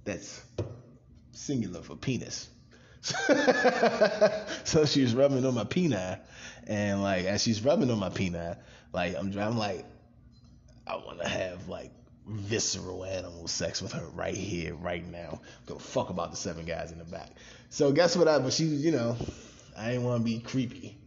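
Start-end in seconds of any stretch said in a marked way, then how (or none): 3.0-4.6 high, loud burst of laughter; long, high-pitched real cackle; laughing. Real, not acted. Cracks up at own joke. 10.9-17.3 hushed inner thought. Retold or imitated. Voices his lustful private thought.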